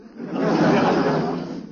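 long, high cackling laugh; high cackle. Acted. Uncle laughing at his own joke, high cackle.

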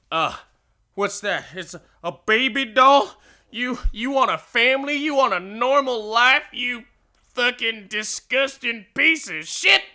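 high, loud, jeering tirade; mocking rage. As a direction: taunt him cruelly, building to a furious insult. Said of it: "Sickles just".

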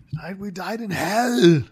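relishing a Scottish battle cry; imitated Scottish accent. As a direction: draw out HELL in a mock Scottish accent. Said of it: "the way he says hell with that Scottish accent".

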